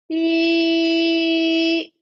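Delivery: long, loud, high ee; sustained "ee". Shown as a demonstration. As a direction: project ee on one steady note. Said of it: "So I'm gonna go".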